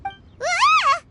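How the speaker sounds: sudden high startled "Ah!"; jumpy little cry of alarm; startled yelp. Acted. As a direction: caught off guard, yelp it. Startled by Baizhu's sudden call.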